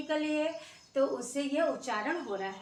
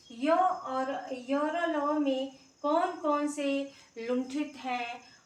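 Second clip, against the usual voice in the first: slow, spelled-out; much slower than usual. Shown as a demonstration. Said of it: "कभी-कभी ये पूछा जाता है".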